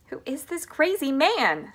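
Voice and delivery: high, loud, indignant demand; outraged scream. A narrator's reading. Grandma Josephine demands who Wonka is, outraged cry. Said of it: "screamed Grandma Josephine".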